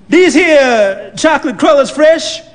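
put-on high, loud, bossy old lady; demanding old woman. Old woman demanding answers from the clerk, high and loud. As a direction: press him hard on freshness.

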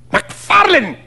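high, appalled exclamation; shocked cry. A narrator's reading. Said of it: "cried Fettes".

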